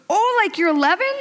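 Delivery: mock boy's high-pitched question; imitating her son. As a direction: pipe up like a child making a connection. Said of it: "And he goes".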